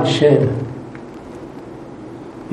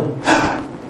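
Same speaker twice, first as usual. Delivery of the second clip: gasp; 6 dB quieter than usual. Retold or imitated. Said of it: "This is what she said".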